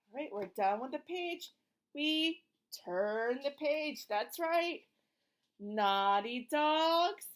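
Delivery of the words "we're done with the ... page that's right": ordinary delivery, nothing marked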